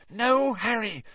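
high, frightened gasp; petrified gasp. A narrator's reading. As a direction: gasp it out in terror. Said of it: "Hermione gasped in a petrified whisper".

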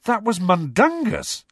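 high, incredulous exclamation; astonished. A narrator's reading. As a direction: blurt it out in disbelief. Harry astonished by the revelation.

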